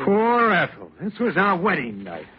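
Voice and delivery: high-pitched indignant retort; hurt, jealous outburst. Acted. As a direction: throw the excuse back at her, wounded and high. Jealous husband scorns wife's excuse.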